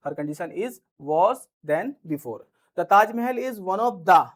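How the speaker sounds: each word spelled out slowly for students to write; slow dictation. Shown as a demonstration. Slow dictation of example sentences.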